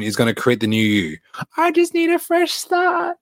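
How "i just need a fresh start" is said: high-pitched, slow mock excuse-maker; mocking whine. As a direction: parody a self-pitying excuse in a squeaky high voice.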